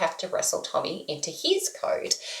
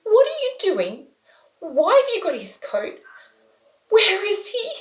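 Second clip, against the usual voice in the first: higher, louder, frantic questions; retold panic; 13 semitones higher, 6 dB louder than usual. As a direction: pitch up in alarm, demanding answers. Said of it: "And then I start to panic".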